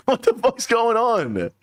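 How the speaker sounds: slow high baffled question; confused disbelief. Retold or imitated.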